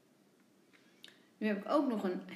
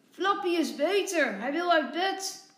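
higher, louder glad call; 5 semitones higher, 6 dB louder than usual. A narrator's reading. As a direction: call out joyfully that the dog is well. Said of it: "roept Tommy".

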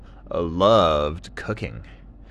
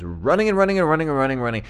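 rapid rising run of repeats; breathless-paced storytelling rush; hurried running; 7 semitones higher, 3 dB louder, much faster than usual. A narrator's reading. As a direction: rattle it off like endless running. Dingo running on and on.

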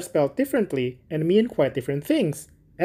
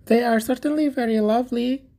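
higher, eager simple gardener voice; little Hans; 9 semitones higher than usual. Acted. Hans thanking the miller for asking, high and eager. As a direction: play Hans as a humble, grateful friend. Hans about his primroses.

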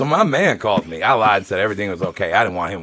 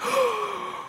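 high sharp mock gasp; audible gasp; 8 dB quieter than usual. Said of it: "audible gasp".

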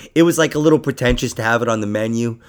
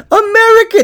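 very high, loud mock bellow; drunk English shout; 10 dB louder than usual. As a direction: yell it like a drunk fan. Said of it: "English people would be like".

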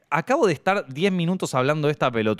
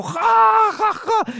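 very high, forced ha ha ha; the crowd laughing along, falsetto; mock fake laugh; 5 dB louder, much slower than usual. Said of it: "Y todos como".

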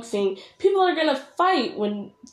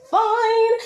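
drawn-out high "fine"; 11 semitones higher, 5 dB louder than usual. Real, not acted. Creator brushes off viewers who will yell, high drawn-out "fine". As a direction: pitch leaping up on a long "fine".

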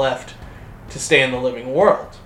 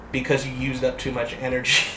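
ordinary talk

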